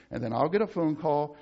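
ordinary talk, nothing marked

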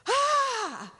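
sudden high, loud "Ah!" of surprise; surgeon's excited shout. Retold or imitated. Surgeon startles his patient with a loud excited ah. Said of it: "I heard the surgeon shout".

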